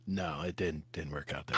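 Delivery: very low, near-whispered admission; quiet, deflated murmur; rueful, hushed. Real, not acted. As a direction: drop right down, almost under your breath. Rueful admission his post failed.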